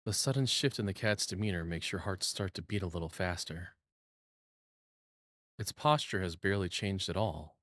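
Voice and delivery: steady, lightly hushed narration voice; calm second-person narration with a touch of tension; story narration. Acted. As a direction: read the scene like a storyteller building suspense. Reads game narration aloud.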